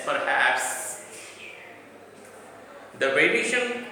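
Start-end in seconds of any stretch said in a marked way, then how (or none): 0.0-1.0 "perhaps" with weight on HAPS; stress on final syllable. Shown as a demonstration. Teacher demonstrating final stress to students, "perhaps" stressed on HAPS. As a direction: lean on the second syllable of "perhaps". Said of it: "we give more stress to the final".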